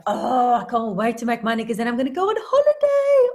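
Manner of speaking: loud, high, rising excitement; super excited. Acted. Person telling puppy of money and holiday, super excited. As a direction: build to a high squeal of anticipation. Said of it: "your puppy gets super excited".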